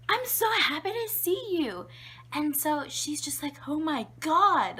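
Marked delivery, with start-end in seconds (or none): 0.1-1.9 put-on high-pitched, loud toddler glee; overjoyed baby. Baby greeting horrified mom, overjoyed. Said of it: "the happiest baby in the world". 3.7-4.8 high, loud, drawn-out mock shock; astounded mom. Mom reacting to poop everywhere, astounded. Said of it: "she's astounded".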